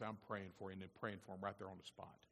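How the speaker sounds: low, soft reassurance; tender, close. Acted. Praying for a hurting person on the spot, soft and tender. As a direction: say it softly, as if holding them close. Acts a close, prayerful embrace.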